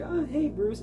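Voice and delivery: lightly voiced Jessica. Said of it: "Jessica is more like".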